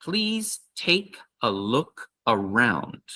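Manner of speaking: slow, clear invitation; slow model phrase. Shown as a demonstration. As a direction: say it slowly for learners. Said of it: "add this one at the end".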